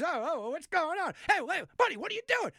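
high, loud, frantic mock outcry; mock alarm. Onlookers to the bettor freaking out, in mock alarm.

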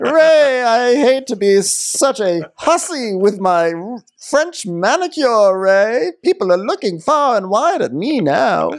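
high, hammed-up grande dame; Karen. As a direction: announce it theatrically in a high voice. Co-host continues Karen impression.

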